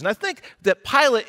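ordinary delivery

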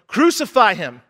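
loud, emphatic mob demand; imitating a crowd's shout; retold crowd yell. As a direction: yell it like an angry crowd, in short bursts. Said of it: "And the crowd yelled".

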